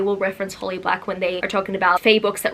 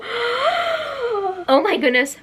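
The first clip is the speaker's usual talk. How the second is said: high, slow, breathless wonder; awed gasp; 13 semitones higher, much slower than usual. Real, not acted. Showing viewers the overlays, slow high awe.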